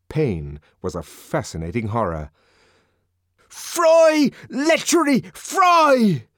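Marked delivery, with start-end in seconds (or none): 3.5-6.3 high, loud, frenzied cries; frenzied shout. A narrator's reading. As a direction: scream it while lashing out. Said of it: "Frenzied, the savage slashed".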